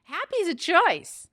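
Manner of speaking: lightly voiced husband laying down the law; husband's advice. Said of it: "And he goes".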